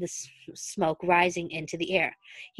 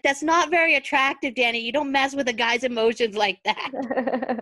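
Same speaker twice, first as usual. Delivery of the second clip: indignant scolding; 5 semitones higher, 5 dB louder than usual. Retold or imitated. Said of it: "And Stiles is like".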